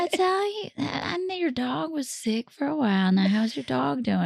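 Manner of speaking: high-pitched mock Southern chitchat; imitated Southern pleasantries. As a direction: coo it like a chatty Southern caller. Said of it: "They're like".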